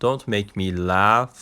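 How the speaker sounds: "Don't make me laugh." spoken very slowly, word by word; slow pronunciation demo. Shown as a demonstration. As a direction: model it slowly for students to copy. (تلفظ آهسته برای شاگردان)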